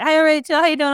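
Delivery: high, loud call. Retold or imitated. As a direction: call up to someone. Retold staff calling up to her.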